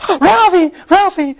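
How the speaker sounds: high, loud mock shriek; imitated scream. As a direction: shriek the name like a horrified movie mom. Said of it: "and she screams".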